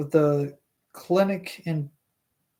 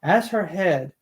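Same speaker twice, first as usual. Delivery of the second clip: plain reading voice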